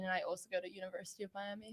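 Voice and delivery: mumbled softly, away from the mic; too quiet. Real, not acted. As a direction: trail off under your breath, fast. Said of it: "Speaking to the mic a little bit".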